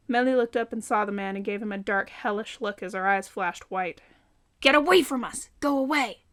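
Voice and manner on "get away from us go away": loud, higher commanding yell; shouting. A narrator's reading. Melly yells at the dark man on the tracks, loud. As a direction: drive the figure off with a shout.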